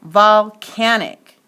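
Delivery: volcanic loud and slow, CAN accented; oomph on CAN. Shown as a demonstration. Teacher showing a clear accent, CAN stressed. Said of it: "it gets the most oomph".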